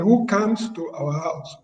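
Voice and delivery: "comes" with an open "a", like "cams"; slow imitation, "comes" said as "kahms"; Ghanaian pronunciation. Panelist quoting the lady in the video, Ghanaian pronunciation. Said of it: "she's a Ghanaian".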